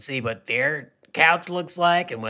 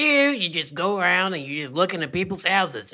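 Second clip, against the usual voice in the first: usual speaking voice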